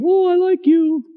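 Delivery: high falsetto reply; mock a sweetheart in falsetto; put-on high girlish voice; girlfriend's voice. Acting the woman's replies, falsetto.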